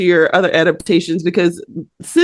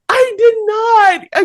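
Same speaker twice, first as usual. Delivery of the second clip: mock wail; 12 semitones higher than usual. Host admitting she forgot the podcast, mock wail. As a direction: wail it out in fake despair.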